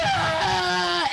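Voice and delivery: a high fake wail, hammed up; fake wailing cry. Kid bursts into a fake wail at the stranger. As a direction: kid fakes a cry to draw a crowd. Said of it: "starts wailing as loud as he can".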